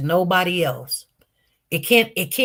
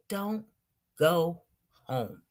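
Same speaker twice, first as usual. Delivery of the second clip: far softer, dropping low on home; soft, hushed; 3 semitones lower, 11 dB quieter, slower than usual. Retold or imitated. God's voice speaking to him, very soft and low. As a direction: breathe it out gently, almost a whisper. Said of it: "he says it like this".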